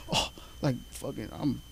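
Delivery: breathy whispered aside, barely voiced; mock whisper. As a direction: whisper it to yourself, almost no voice. Inner thought while spitting game.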